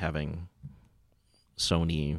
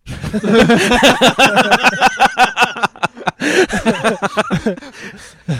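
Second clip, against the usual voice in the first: long, loud, high-pitched cackling laugh; big laugh; 10 dB louder than usual. Real, not acted. Host cracks up at own Call of Duty quip, uproarious laugh. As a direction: burst out laughing and keep it going.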